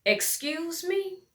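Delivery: high, slow, outraged; high drawn-out indignant excuse me; offended excuse me. Acted. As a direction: stretch it out, indignant. Offended retort.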